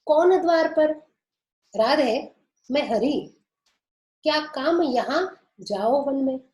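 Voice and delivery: dialogue voices. Acted. Teacher acts Radha-Krishna dialogue.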